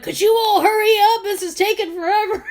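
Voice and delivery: loud, high, impatient complaint; impatient yell. Retold or imitated. Impatient man yelling in line.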